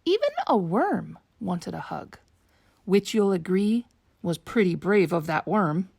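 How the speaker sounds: ordinary delivery, nothing marked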